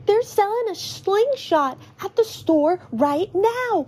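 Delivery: put-on high, excited kid voice; excited child, pitched high; Tallow, excited. A narrator's reading. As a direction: gush over thrilling news. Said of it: "Tallow says excitedly".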